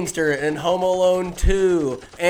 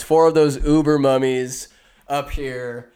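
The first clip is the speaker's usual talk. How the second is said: ordinary talk, nothing marked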